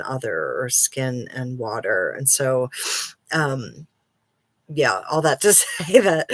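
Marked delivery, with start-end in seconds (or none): 4.7-6.3 louder, breaking into a laugh; real laughing. Real, not acted. Wrapping up a long tangent on float tanks, laughing. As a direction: laugh your way out of the digression.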